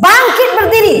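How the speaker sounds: high, ringing order to stand; preacher's commanding call to rise; firm command. Retold or imitated. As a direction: call her up with authority.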